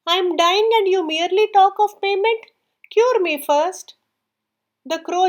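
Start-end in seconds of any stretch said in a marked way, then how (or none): 0.1-4.0 higher, pleading, the last words slowed; a bird begging to be cured first, mildly anguished; dying owl's plea. A narrator's reading. Sick owl begs the cuckoo for treatment, higher anguished plea. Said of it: "the owl gave a terrible moan".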